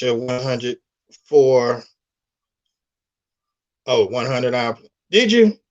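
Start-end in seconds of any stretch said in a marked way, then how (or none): 5.1-5.6 excited. Real, not acted. Surprised a friend finished the hours.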